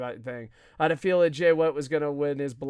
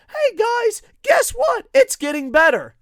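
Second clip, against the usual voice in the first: high, loud parody of excited fans; mock cheery fans; 9 dB louder than usual. Podcaster imitating optimists online, mock excited shout. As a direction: shout it like a gushing social media fan. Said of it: "everyone goes".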